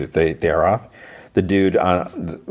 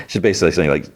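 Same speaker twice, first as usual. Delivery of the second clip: normal talking voice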